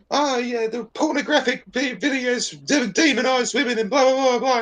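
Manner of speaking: high, prissy parody of a lecturing porn actress; mocking porn star. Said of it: "some porn shealer sitting on a couch going".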